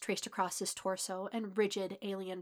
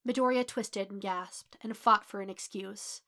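plain reading voice

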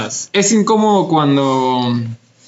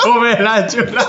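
laughing; 6 semitones higher, much slower than usual. Real, not acted. Punchline amid group laughter.

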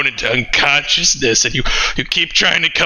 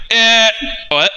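mock disbelief, pitched up; startled eh what; 7 semitones higher than usual. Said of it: "everyone's like".

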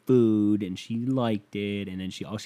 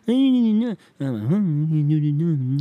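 slow, higher, louder mocking drawl; mocking girlfriend; 6 semitones higher, 8 dB louder, much slower than usual. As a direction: stretch it out like a teasing girlfriend. Mimicking girlfriend mocking him.

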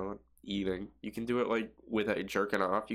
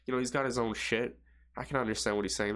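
usual speaking voice

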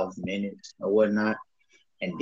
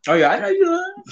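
laughing; 6 dB louder than usual. Real, not acted. Laughing disbelief, high.

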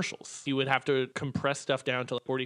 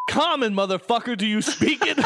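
shouted Jackson parody; 8 semitones higher, 5 dB louder than usual. Pretend. Pulp Fiction parody line.

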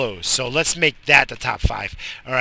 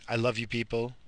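ordinary delivery

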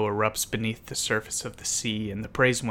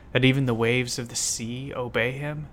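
ordinary delivery

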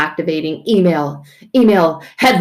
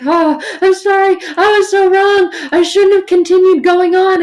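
high, louder mock sorry whine; hammed-up remorse; 12 semitones higher, 8 dB louder than usual. People to God, a high mock-remorseful whine. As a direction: wail an over-the-top apology.

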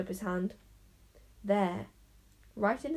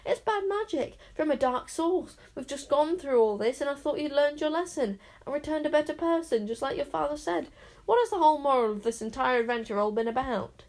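much higher, agitated pleading; flustered scolding; 6 semitones higher, 4 dB louder than usual. A narrator's reading. Worried raven scolding the reckless boy, agitated. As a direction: fret and scold in alarm. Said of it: "grabbled poor Caliburn".

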